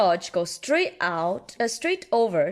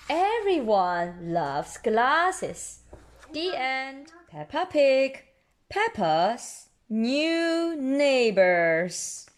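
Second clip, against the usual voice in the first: plain reading voice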